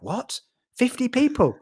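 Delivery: disbelieving jump up high on fifty people; retold shock. Telling a host how he reacted to the call, in mock disbelief. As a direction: replay the moment the news landed.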